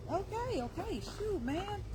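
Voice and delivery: high, soft startled yelps at the wasp; jumpy high-pitched okays; startled squeal. Real, not acted. Startled by a wasp, squeaking high.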